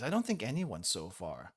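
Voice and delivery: ordinary talk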